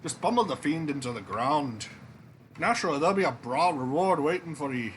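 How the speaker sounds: put-on untrained movie Scottish accent for a king; a bluff Scottish king, in a movie Scottish accent. King recruiting the hero Jack, in a Scottish accent. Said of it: "my Scottish accent".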